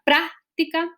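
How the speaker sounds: práctica as PRÁC-ti-ca, first syllable held high; stress on PRÁC. Shown as a demonstration. Teacher offering the first option, stress on PRÁC. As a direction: lean on the opening syllable of práctica. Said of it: "dónde estoy poniendo la, la mayor, ah, fuerza".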